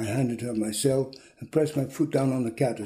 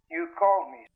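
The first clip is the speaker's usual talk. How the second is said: thin telephone-line voice, pitched up; higher, tinny phone caller; 6 semitones higher than usual. A narrator's reading. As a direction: caller heard down the line, higher than the narrator. Man's voice on the phone.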